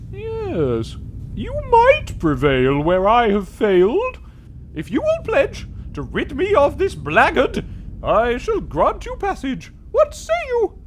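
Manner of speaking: high, slow spectral wail; ghostly warning. Acted. Player voicing the guardian spirit, slow eerie wail. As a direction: warn the living away, drawn out.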